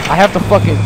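ordinary talk, nothing marked

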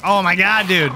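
panicking gamer. Real, not acted. Streamer startled by game scare.

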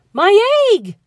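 short, startled outcry; startled shout. A narrator's reading. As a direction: call out in alarm. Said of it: "shouted Horton".

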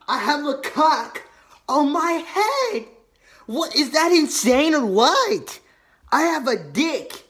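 loud, mocking yell; mock shouting. Mocking friend's drawing, shouting.

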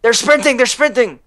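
high, frantic warning; panicking gamer. Real, not acted. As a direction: call it out in panic. Enemies rushing in.

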